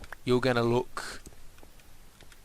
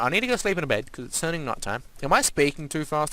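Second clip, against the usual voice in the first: usual speaking voice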